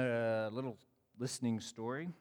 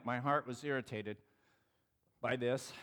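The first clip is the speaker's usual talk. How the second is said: ordinary talk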